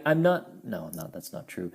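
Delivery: usual speaking voice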